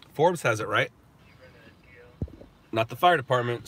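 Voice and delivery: ordinary talk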